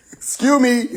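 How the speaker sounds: high, snooty voice; mock snooty high voice; arrogant tsunami.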